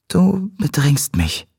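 put-on slow, high girl's voice; Cat, feeling crowded. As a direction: draw it out, pitched well up. Cat feels crowded by Damon. (hohe, gedehnte Mädchenstimme)

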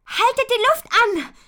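sehr hoch und laut schreien; geschrien. Acted. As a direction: panischer Warnschrei an die Freunde. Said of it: "schreit Ponyfee". (schreiend, höchste Not)

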